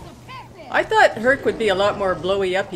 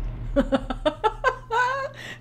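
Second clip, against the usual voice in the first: giggle. Real, not acted. Laughing at the cute pet.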